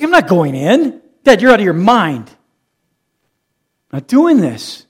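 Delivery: defiant son. Retold or imitated. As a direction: toss it off like a stubborn teenager. Son defying father, acted.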